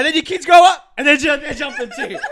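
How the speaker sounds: loud and high, laughing; hyped laughing joke. Pretend. Joking about kids in the mosh pit, loud and excited. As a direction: build it up, hyped.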